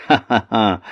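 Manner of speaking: taunting ha ha ha; mocking laugh. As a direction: laugh at the troll. Boy mocking troll.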